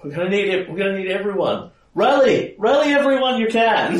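high voice building to a shout; high, urgent battle summons; urgent rallying cry. Acted. Character rallies defenders against monsters.

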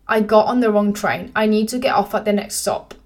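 ordinary delivery

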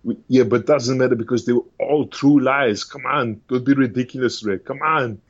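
put-on Austrian-accented action star brushing it off; dismissive Austrian accent; Schwarzenegger impression. Arnie waves off the preacher in an Austrian accent. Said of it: "Arnold Schwarzenegger doing the good person test".

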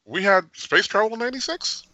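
acting out puzzled disbelief; incredulous. Co-host recalling reaction to the episode's launch date, incredulous. Acts out own surprised reaction.

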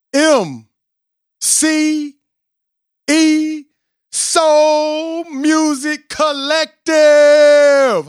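drawn-out, high, loud announcer shout; big hype-man call of the band name; hype introduction. Real, not acted. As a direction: stretch the group name like a ring announcer. Hype host introducing the group.

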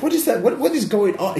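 flustered priest; confused priest. Acted. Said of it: "the priest, is like".

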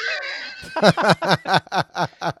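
high, loud burst of laughter. Real, not acted. Host cracks up at the masked guest, loud high laughter. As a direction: crack up loudly and at length.

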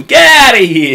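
high, loud squeaky dolphin chatter. Acted. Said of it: "it's a dolphin voice".

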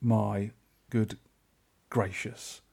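halting, fading swoon; savouring awe. Acted. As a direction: pause between words, sinking into bliss. Swooning over remembered food.

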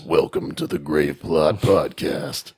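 low, slow dramatic announcer; deep dramatic voice. Pretend. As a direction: drop low and draw it out dramatically. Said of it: "deep and dramatic like this".

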